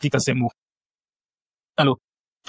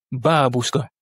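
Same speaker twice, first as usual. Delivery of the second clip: BA-bushka, first syllable of Babushka drawn out; stress on first A; slower than usual. Shown as a demonstration. Teacher asks if you see the accentuation, stress on first A. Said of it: "Vous voyez comment on accentue?".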